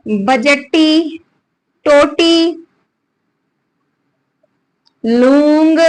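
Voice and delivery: बजटी drawn out very slowly, syllable by syllable; slow dictation. Shown as a demonstration. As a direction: spell out बजटी slowly for writing down. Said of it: "लिखिए सबसे पहले बजटी".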